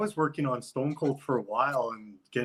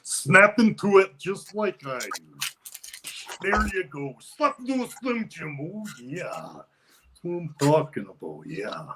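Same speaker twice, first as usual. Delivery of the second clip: Macho Man impression. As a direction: cut a Slim Jim promo as Macho Man. Macho Man Slim Jim promo.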